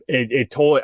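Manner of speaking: normal talking voice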